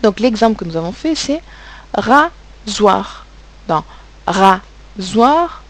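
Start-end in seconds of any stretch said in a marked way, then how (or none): 1.9-3.2 RASOIR in two beats, ra, pause, zoir; split into syllables. Shown as a demonstration. Teacher showing the class two syllables, split into syllables. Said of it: "on entend bien deux syllabes". 4.3-5.6 RASOIR in two beats, ra, pause, zoir; split into syllables. Shown as a demonstration. Teacher showing the class two syllables, split into syllables. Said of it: "on entend bien deux syllabes".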